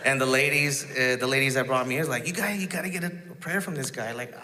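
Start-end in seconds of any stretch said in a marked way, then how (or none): 2.3-4.1 a hint of a higher, eager lady's voice; lightly voiced lady pushing him to go; mildly raised, insistent church lady; urging church lady.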